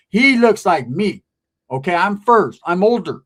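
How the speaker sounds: insistent protest. Retold or imitated. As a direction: insist you're first. Arguing with the girl.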